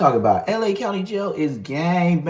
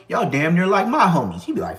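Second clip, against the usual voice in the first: usual speaking voice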